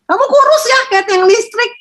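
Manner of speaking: very high, loud, sneering tease; sing-song jeer from a bullying parent, way up high; mocking taunt. Parent taunting a skinny child in a high, loud, jeering voice. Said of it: "kita bully anak kita".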